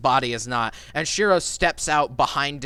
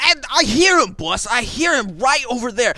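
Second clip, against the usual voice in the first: eager henchman; 8 semitones higher, 5 dB louder than usual. Acted. Henchman voiced by GM.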